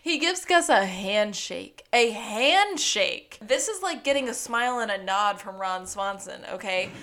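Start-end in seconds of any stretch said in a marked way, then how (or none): 0.7-3.4 "handshake" drawn out slow and loud; slow, loud stress on "handshake"; amazed emphasis. Real, not acted. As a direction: stretch "handshake" in disbelief. Marvels at a rare gesture, repeating it.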